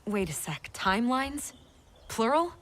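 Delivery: high, startled questioning; incredulous. Acted. Listener hearing of many timelines, incredulous. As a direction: question it in rising disbelief. Learns of other timelines, astonished.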